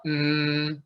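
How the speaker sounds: "mm" met dichte lippen, door de neus; closed-mouth mm. Shown as a demonstration. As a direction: hum "mm" with lips shut. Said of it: "and close your mouth".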